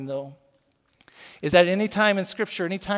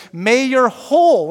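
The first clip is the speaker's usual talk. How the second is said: whole loud and slowed; stress on whole; 7 semitones higher, 12 dB louder, slower than usual. Shown as a demonstration. Pastor pausing on the word whole, loud. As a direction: hit whole hard. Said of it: "we'll stop right there".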